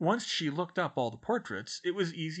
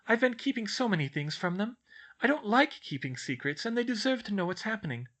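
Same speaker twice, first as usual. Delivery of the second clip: put-on high, earnest girlish outpouring; spilling out; 8 semitones higher, 4 dB louder than usual. A narrator's reading. Schoolgirl unburdens herself to her professor, words spilling out. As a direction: pour out the worries without holding back. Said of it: "the words came spilling out".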